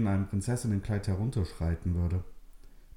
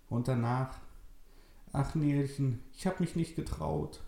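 put-on higher, thin old lady voice; old woman Maria; 6 semitones higher than usual. Old Maria tells Nele it's true, frail old voice. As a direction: speak as a frail old grandmother.

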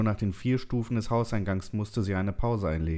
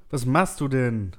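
higher, slow, drawn-out called question; calling out; 5 semitones higher, 4 dB louder than usual. A narrator's reading. Narrator calls to the woman walking ahead, calling out. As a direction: call the question over to her. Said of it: "rief ich ihr zu".

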